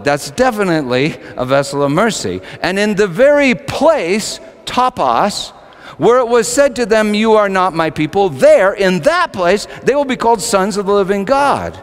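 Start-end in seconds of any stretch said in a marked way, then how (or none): 2.6-11.8 high, loud, ringing scripture reading; fervent scripture reading. Acted. Preacher proclaiming Hosea to the congregation, fervent. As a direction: proclaim the verse with full force.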